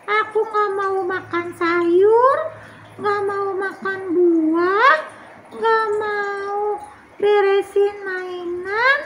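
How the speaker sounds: put-on high, slow child voice listing; little Tiur. Tiur complaining to her sister about Mama scolding, high and slow. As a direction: list it like a sulky little kid.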